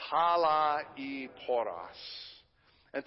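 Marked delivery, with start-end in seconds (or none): 0.1-1.8 talaiporos said slowly, syllable by syllable; talaiporos, each syllable clipped apart and drawn out; staccato. Shown as a demonstration. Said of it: "And one more time".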